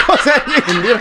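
high-pitched laughing protest; high, amused mock innocence; laughing denial. Real, not acted. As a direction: deny it while cracking up. Laughing denial when caught.

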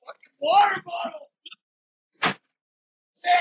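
high exclamation of annoyance; sudden high-pitched swearing at a mishap; real exasperated outburst. Real, not acted. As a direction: curse the thing out, voice jumping up. Sudden mishap with water bottle.

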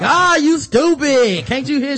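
high, loud, slow scolding yell; furious shout. Retold or imitated. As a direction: scream at her in rage. Doctor berates nurse.

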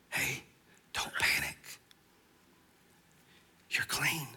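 soft, whispered calming of a panicked friend; Jesus whispering comfort to Peter; soothing whisper. Acted. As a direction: hush him gently like calming a child.

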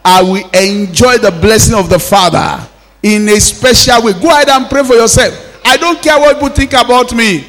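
loud, ringing preacher's declarations; forceful revival-meeting prayer; full-force prayer. Acted. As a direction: drive each prayer line out to the congregation. Leads congregation in forceful prayer.

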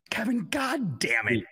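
high, drawn-out mock outburst; exasperated cursing. Host re-enacting his reply to Kevin, exasperated. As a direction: stretch the curse in exasperation.

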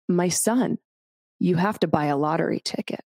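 normal talking voice

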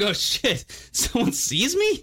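high, surprised bum voice; high-pitched panicked bum, half laughing; startled bum. Retold or imitated. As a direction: squeak it out like a caught man, laughing. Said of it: "It's like".

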